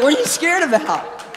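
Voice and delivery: high-pitched mock bafflement; exaggerated puzzled outburst; retold incredulous question. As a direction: ask it high, playfully incredulous. Retold reply to scared teen.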